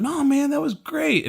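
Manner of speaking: high-pitched, unconvincing praise; false reassurance. Retold or imitated. Buddies to comic who bombed, falsely reassuring. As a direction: pitch way up, insisting kindly but falsely. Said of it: "you're being dishonest with me".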